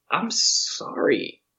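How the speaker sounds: slow, breathy, hammed-up apology; bow and scrape, drawing it out; slow, high, mock-humble; mock groveling. Guard suddenly backs down, apologizing.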